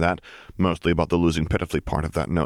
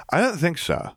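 higher, pleased and grand; Lily playing a drug lord; 11 semitones higher than usual. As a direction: savour it like a boss back in charge. Lily answers.

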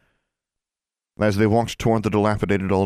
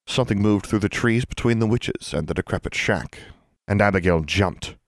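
ordinary delivery

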